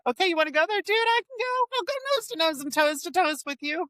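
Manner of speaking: high-pitched mock confrontation, no real shouting; retold screaming challenge. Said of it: "I'm just screaming at him".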